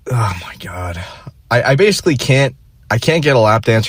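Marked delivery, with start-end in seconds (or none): 0.1-1.0 breathy whispered despair; a hushed oh my God, all air. Real, not acted. To himself over the mess, whispered.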